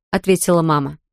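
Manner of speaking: usual speaking voice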